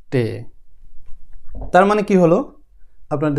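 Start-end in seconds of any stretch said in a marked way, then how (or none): 0.1-0.2 টে said as the German letter T, not টি; teacher modelling টে for learners; German letter sound. Shown as a demonstration. German T letter name. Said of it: "টি এর উচ্চারণ যাই".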